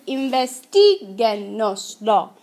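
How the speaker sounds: "investiguenoslo" with the I of "ti" punched; stressed syllable. Shown as a demonstration. Teacher showing the class the accented I, stressed "ti" in investiguenoslo. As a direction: break up investiguenoslo, leaning hard on TI. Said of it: "We had to accent the I".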